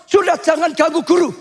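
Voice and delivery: high, exasperated mock rebuke; scolding. Crowd orders the blind man to leave the teacher alone, scolding. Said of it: "orang-orang banyak negur dia".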